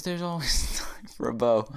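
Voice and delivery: Irish elf line broken by laughter; giggly, half-managed Irish accent; attempted Irish accent. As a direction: try the Irish accent while cracking up. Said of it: "But she just says".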